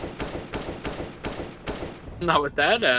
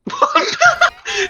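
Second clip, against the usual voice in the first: high-pitched laugh. Real, not acted. Laughing at a teammate's voice.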